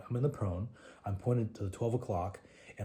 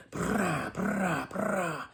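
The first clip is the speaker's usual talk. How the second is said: mock gunfire; 9 semitones higher, much slower than usual. Imitating gunfire in firefight.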